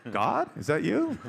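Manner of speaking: high, loud, drawn-out wonder; awed question. Retold or imitated. Son answering the booming operator, mock awe. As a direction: ask it as if God phoned.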